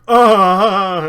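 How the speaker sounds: a loud, high wail of grief; anguished cry. Acted. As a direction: let out a big anguished "Ah!" before collapsing. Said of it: "he just kinda goes like".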